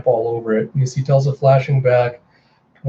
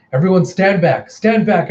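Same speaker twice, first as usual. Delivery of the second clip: urgent order; 5 semitones higher, 4 dB louder than usual. Retold or imitated. Healer orders people back.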